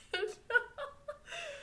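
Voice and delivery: high squealing laugh. Real, not acted. Reacting to half-naked Takumi, squealing laugh. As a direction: let a squeaky laugh escape. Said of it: "I think that's hilarious".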